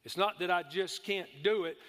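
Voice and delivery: ordinary talk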